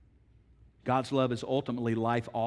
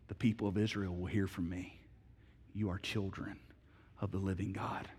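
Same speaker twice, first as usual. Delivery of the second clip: soft, low, tender divine promise; tender voice of God; 7 semitones lower, 10 dB quieter than usual. Retold or imitated. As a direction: speak it gently as God. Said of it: "God says".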